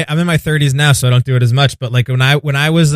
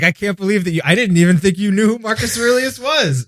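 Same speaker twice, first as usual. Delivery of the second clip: high, incredulous mock disbelief; mocking Kara Swisher; 7 semitones higher, 4 dB quieter than usual. Guest imitating Kara Swisher's scoffing, pitched up. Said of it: "she was like".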